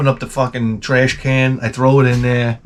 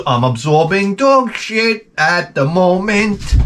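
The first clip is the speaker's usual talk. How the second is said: mock horror; 7 semitones higher, slower than usual. Dog mess on bare foot.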